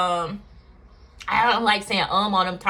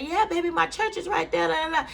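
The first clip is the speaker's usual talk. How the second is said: friendly lady's line in a much higher voice; imitating the church lady; 8 semitones higher than usual. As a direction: lift the voice well up to play the lady. Voicing the church lady's reply.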